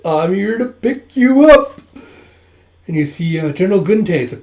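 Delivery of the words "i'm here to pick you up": high, loud, slow sing-song; playful announcement. Acted. Hyungdae cheerfully announces he came to fetch him. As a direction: tease as you arrive.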